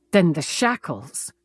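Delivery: ordinary delivery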